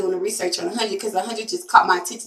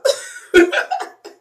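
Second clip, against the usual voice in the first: short laugh; 8 semitones higher than usual. Real, not acted. Laughing at own slang.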